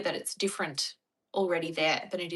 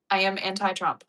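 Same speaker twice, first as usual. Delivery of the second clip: usual speaking voice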